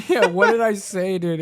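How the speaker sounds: talking through a laugh. Real, not acted. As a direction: laugh out the words. Laughing at text.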